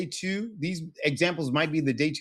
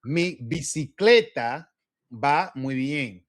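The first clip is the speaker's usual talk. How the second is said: very slow, phrase by phrase; slow pronunciation model; slower than usual. Shown as a demonstration. Teacher modeling a Spanish sentence for a student, slow and deliberate. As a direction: spell it out by ear, word by word. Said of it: "now listen to me".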